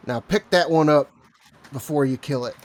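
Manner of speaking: loud barked order; loud command. Acted. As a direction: shout the order. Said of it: "And I will say".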